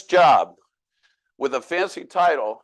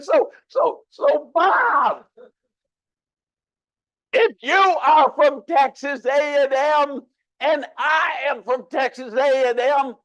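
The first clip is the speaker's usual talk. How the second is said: much higher and louder, slow and baffled; incredulous loud question; 11 semitones higher, 3 dB louder, slower than usual. Retold or imitated. Dan asks Bob why they're speaking French, high, loud and slow. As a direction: shout the punchline slowly in disbelief.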